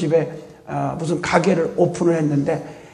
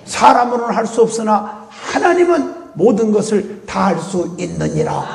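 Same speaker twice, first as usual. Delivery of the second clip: ordinary talk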